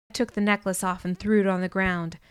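plain reading voice

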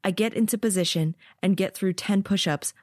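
plain reading voice

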